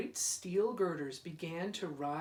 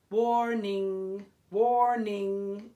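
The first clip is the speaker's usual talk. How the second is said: bell's warning; 3 semitones higher, 8 dB louder, much slower than usual. Acted. Iron bell warning through the fog, loud ringing call. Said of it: "it said".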